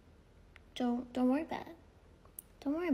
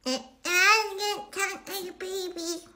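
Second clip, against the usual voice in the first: put-on high, slow baby-talk squeal; mock baby voice; 6 semitones higher, slower than usual. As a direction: drag it out like a whiny toddler. Mock baby-talk against her usual voice.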